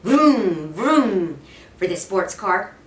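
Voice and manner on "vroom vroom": mouthed engine vroom; car vroom. A narrator's reading. Boy playing with toy cars, vrooming engine noise. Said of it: "making noises with his mouth".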